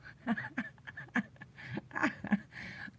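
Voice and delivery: long, breathy real laughing fit; helpless laughter. Real, not acted. Storyteller recalling the deleted cow video, helpless laughter. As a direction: laugh on and on at the memory.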